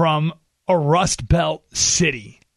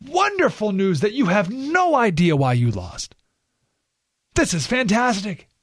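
mock delight; 4 semitones higher, slower than usual. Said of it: "And I said".

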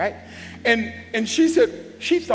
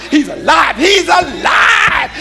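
shouting he's alive; 8 semitones higher, 11 dB louder than usual. Retold or imitated. Preacher voicing the women's joy to the church, shouted. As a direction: proclaim it at full shout.

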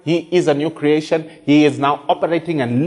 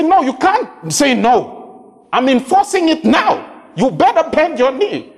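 commanding rebuke; 10 semitones higher than usual. Retold or imitated. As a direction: order the devil to bend his knee. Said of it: "And you say".

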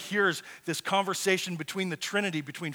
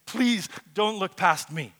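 urgent, raised plea; pleading prayer; 3 semitones higher, 8 dB louder than usual. Retold or imitated. Preacher recalling his own prayer to God, pleading. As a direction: plead with God, louder and insistent. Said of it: "I've prayed this prayer".

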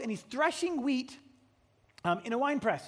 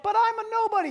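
much higher, pleading; high whiny protest; 11 semitones higher, 6 dB louder, faster than usual. Acted. Gideon objecting to the angel, high whiny plea. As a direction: squeak it out.

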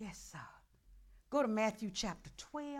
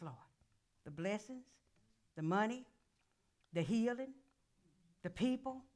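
ordinary talk

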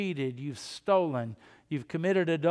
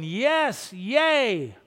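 high, loud joyful yes, yay; excited cheer; 4 semitones higher, 5 dB louder than usual. Retold or imitated. Believers greeting Christ's return with a loud, happy cheer. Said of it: "you're gonna be going".

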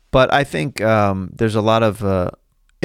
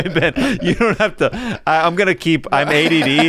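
normal talking voice

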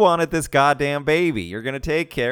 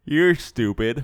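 slow mock retort; slower than usual. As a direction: drag it out, slow and deadpan. Co-host echoes mom line.

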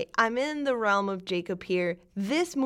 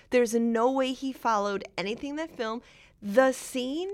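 ordinary talk, nothing marked

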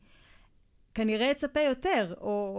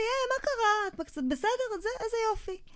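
comforting coo; 10 semitones higher than usual. Retold or imitated. Adult comforting the crying girl, high soothing coo. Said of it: "אמר לי".

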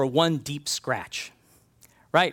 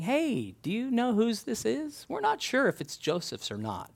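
higher, softer mock-casual question; feigned innocence; 7 semitones higher, 5 dB quieter than usual. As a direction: brothers playing innocent to their father. Brothers feign innocence to father.

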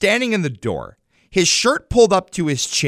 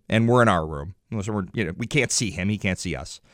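ordinary delivery

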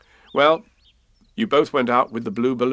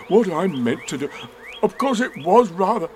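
higher voice, explaining on the way down; flustered falling bear; 7 semitones higher, 3 dB louder than usual. Acted. Bear slithering through branches, flustered explaining. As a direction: keep explaining as you tumble.

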